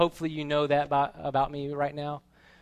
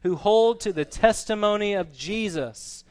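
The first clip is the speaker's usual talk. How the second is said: raised, emphatic scripture quote, building louder; angel's command; 5 semitones higher, 8 dB louder than usual. Acted. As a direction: build to a forceful closing command. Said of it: "But he said to me".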